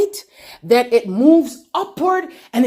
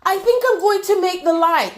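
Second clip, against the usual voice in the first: mock casual God; 7 semitones higher than usual. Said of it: "God did not say".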